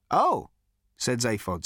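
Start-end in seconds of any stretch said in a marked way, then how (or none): 0.1-0.5 higher, startled little oh; be mildly taken aback. A narrator's reading. Zaphod hears of a party, startled.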